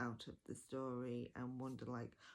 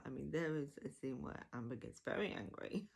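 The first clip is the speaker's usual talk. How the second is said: ordinary delivery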